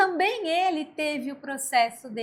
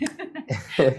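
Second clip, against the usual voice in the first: risada real depois da piada; riso solto; 12 semitones lower than usual. Real, not acted. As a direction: ri com vontade, grave. Riso após a imitação. (risada sincera no fim da história)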